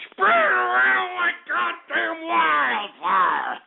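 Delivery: high, drawn-out showman's yell; rousing holler. Real, not acted. Radio host urging listeners to spread the show, hollering. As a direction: stretch it out high, falling away at the end.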